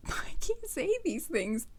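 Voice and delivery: giggling through the words; a giggly confession; laughing. Real, not acted. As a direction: laugh it out, embarrassed.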